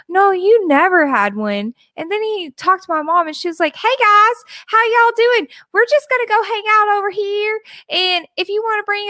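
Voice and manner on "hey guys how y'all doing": normal talking voice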